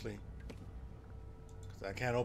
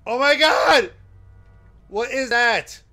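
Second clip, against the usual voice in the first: startled shout; 10 semitones higher, 15 dB louder, slower than usual. Real, not acted. Jump scare at the door.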